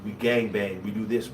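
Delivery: normal talking voice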